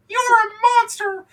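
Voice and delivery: very high, loud shriek. Acted. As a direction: scream it at the top of your lungs. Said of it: "and you just hear".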